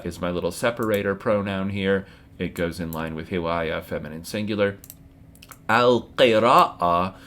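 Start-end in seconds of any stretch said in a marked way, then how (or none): 5.7-7.1 slow, careful Arabic model word. Shown as a demonstration. Teacher modelling al-qira'a slowly, in careful Arabic. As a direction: spell it out slowly for the class. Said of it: "is the masdar for qara'a".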